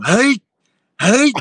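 loud, high-pitched yelped "hey"; imitated guru grunt. Guru being lifted off the floor, imitated high yelp.

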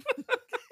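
squealing high laugh. Real, not acted. Laughing at own impression.

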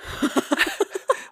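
airy, embarrassed laugh rising; embarrassed breathy laugh. Real, not acted. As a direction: laugh it off awkwardly. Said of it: "now you're making it weird".

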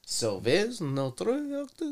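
slow, mock French accent read; hammed-up Parisian French accent. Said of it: "you can use your Paris voice".

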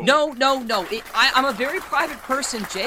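loud, flustered objection; loud protest. Acted. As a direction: push back hard, rattled. Protests loudly against the show.

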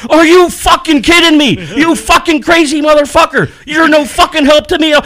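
high, loud angry yelling; furious shout. Retold or imitated. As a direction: yell at him in a rage. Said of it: "He starts going off on this fucking guy".

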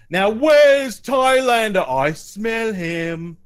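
very high, loud, hugely drawn-out giant; hunting Cyclops. Acted. Cyclops sniffing out his quarry, bellowing. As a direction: bellow slowly like a monster on a scent. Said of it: "He says".

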